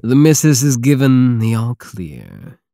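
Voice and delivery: loud, proud announcement to the whole crew; announcing to the crew. Acted. Pirate captain announcing the queen's consent to his crew, loud. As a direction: shout it across the deck.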